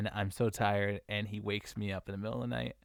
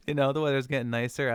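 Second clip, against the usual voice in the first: giggling repeat of the good news; laughing, a bit loud; 4 semitones higher, 5 dB louder than usual. Real, not acted.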